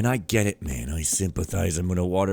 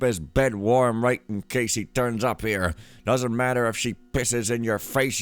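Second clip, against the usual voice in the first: higher, forceful character read; gruff-talking note writer; 6 semitones higher, 6 dB louder than usual. Acted. Player reading a game note aloud, forceful character voice. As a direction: read it out as a bossy underling, higher.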